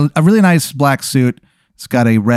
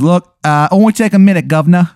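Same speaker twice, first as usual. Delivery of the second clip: loud, high mock English punk; unconvincing English accent; 7 semitones higher, 5 dB louder than usual. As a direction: announce yourself in hammed-up bad English. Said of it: "his accent isn't convincing at all".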